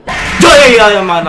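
a sudden frightened yelp; startled shout. Real, not acted. Streamer jolted by a jump scare, real startled yell.

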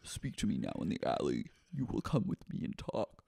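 low, hushed, menacing command voice; quiet put-on villainess, almost whispering; Katie Maloney. Katie summoning him to the alley, low hushed menace. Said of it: "She goes".

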